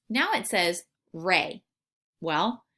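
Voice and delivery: ordinary talk, nothing marked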